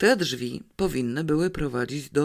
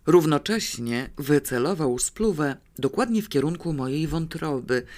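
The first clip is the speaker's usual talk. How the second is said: ordinary delivery, nothing marked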